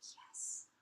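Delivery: hushed, breathy "yes" as a retold reaction; quoted approval. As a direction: whisper an approving yes, quoting yourself. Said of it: "I was like".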